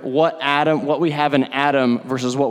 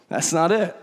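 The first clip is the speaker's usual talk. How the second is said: said through a soft chuckle, quieter; laughing softly; 2 semitones higher, 3 dB quieter than usual. Real, not acted. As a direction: laugh it off gently. Repeats line, second time laughing.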